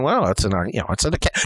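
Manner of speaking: low, mumbly imagined heckler; subdued mock objection; quibbling objector. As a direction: lightly mimic a pedantic listener, voice dropped low. Imitating a quibbling listener.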